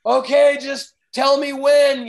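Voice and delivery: loud, high, keyed-up reply; nervous agreement. Retold or imitated. Rider on the back of the ski answering the driver, loud and high. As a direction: brace yourself and shout back. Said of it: "And I'm just like".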